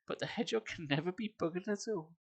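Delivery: sing-song rhythmic verse reading with a heavy beat; poem recitation. Acted. Streamer reads a bawdy song to chat as rhythmic verse. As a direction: recite the verse with a bouncing meter, fighting back giggles.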